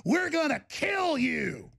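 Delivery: hammed-up threat, stretched out slow; mock scream. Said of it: "a group screaming".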